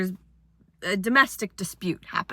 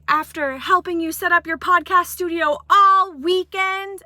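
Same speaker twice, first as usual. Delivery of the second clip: high, loud indignant rant; mock outraged ex; angry ex; 7 semitones higher, 4 dB louder than usual. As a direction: list her grievances furiously. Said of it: "she was like".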